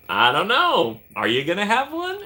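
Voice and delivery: high, rising sing-song question; hammed-up coy reply climbing high; imitating Tom. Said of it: "he's like".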